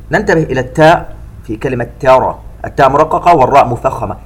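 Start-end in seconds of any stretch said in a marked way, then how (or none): none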